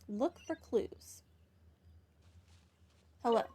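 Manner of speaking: usual speaking voice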